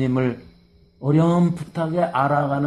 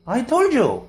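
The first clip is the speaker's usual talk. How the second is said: much higher I told you; impatient retort; 11 semitones higher, much slower than usual. Acted. Blind man rephrased for the congregation, much higher and impatient. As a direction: toss it back at them. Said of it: "이러는 거예요".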